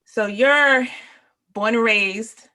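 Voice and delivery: eager question, catching herself midway; lively host energy; excited. Real, not acted.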